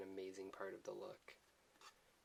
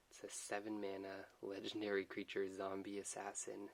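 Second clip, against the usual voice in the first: usual speaking voice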